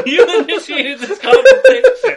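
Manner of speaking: high, loud laughter through words; laughing. Real, not acted. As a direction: laugh helplessly while telling it. Laughing retelling.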